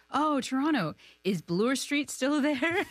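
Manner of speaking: a fond, wondering hometown question; imitating Cameron Crowe. Cameron Crowe asking her about Toronto, curious and slow. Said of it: "He's like".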